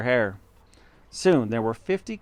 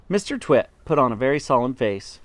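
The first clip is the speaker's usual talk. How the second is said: ordinary delivery, nothing marked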